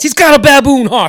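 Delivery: hammed-up high, loud outburst of disgust; mock disgust. Acting out the movie line for a friend, mock-disgusted shout. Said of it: "With disgust".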